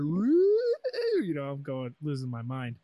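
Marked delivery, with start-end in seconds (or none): 0.0-0.5 loud cheer. Retold or imitated. Crowd cheer on stage.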